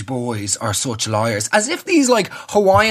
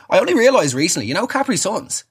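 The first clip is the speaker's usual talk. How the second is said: usual speaking voice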